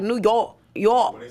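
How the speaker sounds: York with the O and R held hard; exaggerated New York accent. Memphis rapper teases host's York, held O and R. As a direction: mimic New Yorkers leaning hard on the vowel in York. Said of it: "why the fuck y'all hold the O and R so hard".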